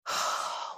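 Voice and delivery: soft, breathed-out sigh; heavy sigh. Real, not acted. Wishing she made different decisions, heavy sigh. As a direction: let out a quiet, weary sigh. Heavy pause after regretful reflection.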